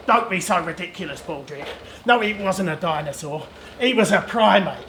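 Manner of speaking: a hint of haughty explorer; dismissive archaeologist. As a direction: put the assistant down in a low character voice. Archaeologist rebukes his assistant.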